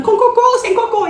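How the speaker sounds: high, mock yell of the question; mock shout. Teacher retelling her supermarket shout to students. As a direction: yell it across the store, half joking.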